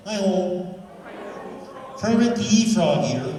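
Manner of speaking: high, loud frog puppet voice; Kermit greeting. Shown as a demonstration. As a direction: do the Kermit hello. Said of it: "Now, let's try that".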